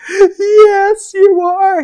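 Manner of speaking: very high, loud, crying answer; crying yell. Retold or imitated. Answering the recruiter's call, very high and loud through tears. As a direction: blurt it through sobs of relief. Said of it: "I just start crying".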